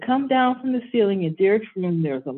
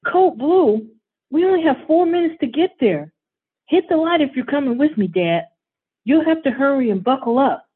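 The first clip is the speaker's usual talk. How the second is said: put-on higher, louder, urgent kid; urgent excited boy; 6 semitones higher, 4 dB louder than usual. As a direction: rush dad out the door. Boy urgently rallying dad.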